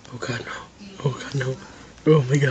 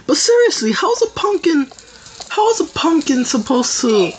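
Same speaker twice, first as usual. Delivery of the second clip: very high exasperated outcry; 11 semitones higher than usual. Real, not acted. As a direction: complain in disbelief. Exasperated complaint.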